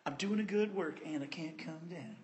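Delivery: whispered motto, voiceless. Shown as a demonstration. Preacher whispering the motto to memorize, whispered. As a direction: breathe it like a secret. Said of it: "good thing for us to memorize".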